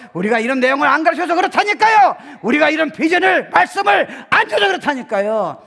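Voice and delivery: loud, high insistent cry; preaching shout. Real, not acted. Preacher insisting to the congregation, loud and high. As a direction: hammer the point home to the pews.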